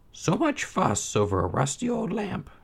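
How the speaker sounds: put-on high old mother's voice, dismissive. A narrator's reading. Mother doubting her son's tale, high and dismissive. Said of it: "she said".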